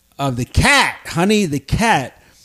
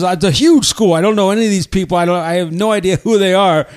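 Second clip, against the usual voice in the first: ordinary talk, nothing marked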